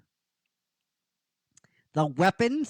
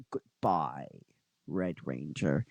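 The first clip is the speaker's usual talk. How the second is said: low, soft menacing farewell; villain's farewell; 14 semitones lower, 7 dB quieter than usual. Acted. Goldar about to swing at Red Ranger, low quiet menace. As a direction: drop low and quiet for the kill.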